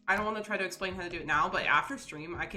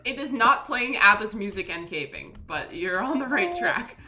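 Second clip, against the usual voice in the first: laughing loudly; 2 semitones higher, 10 dB louder than usual. Real, not acted. Answers laughing and loud.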